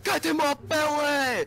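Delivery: high, loud, drawn-out goofy shout; demonstrated belly yell. Shown as a demonstration. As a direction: shout it slowly like a greedy movie brute. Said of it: "smack someone and be like".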